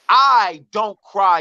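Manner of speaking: "I don't cry" drawn out slowly; emphatic denial. Real, not acted. Man protesting to America, emphatic denial. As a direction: stretch each word of "I don't cry".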